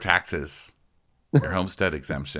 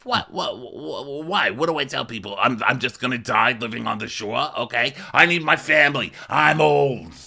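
Frank; 6 semitones higher than usual. Retold or imitated. Frank asked why he's moving home, high hammed-up pleading. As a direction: stammer in mock panic, then wail your case. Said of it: "And he's like".

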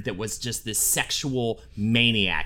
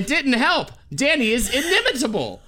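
higher, lightly nasal-free voice of a fussy actor; imitating a famous actor's voice, higher; Wallace Shawn impression; 9 semitones higher than usual. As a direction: read it as the actor himself, higher. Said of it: "I'll do a quote in his own voice".

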